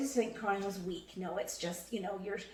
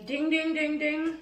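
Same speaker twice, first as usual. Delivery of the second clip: playful bell chime, higher and louder; mock bell ding; 7 semitones higher, 6 dB louder, slower than usual. Co-host flagging a warning sign, playful bell sounds. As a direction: ring it like a game show bell. Co-host marks a lesson learned.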